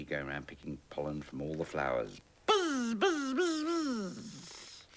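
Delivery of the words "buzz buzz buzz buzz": very high, loud buzzing; bee buzzing. Acted. Bee flying round the flowers, high buzzing. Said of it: "thought the bee".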